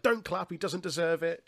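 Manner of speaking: high, loud mock yell; retold scream. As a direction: imitate a wrestler screaming at the crowd.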